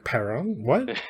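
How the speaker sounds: baffled rising question; puzzled double take, What? jumps up high; reader's baffled reaction; confused. Retold or imitated. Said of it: "And I'm like".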